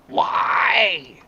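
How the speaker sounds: breathy high cry of despair; exasperated shout. Real, not acted. Vlogger's last outburst at the car, breathy shout. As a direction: shout it into the void.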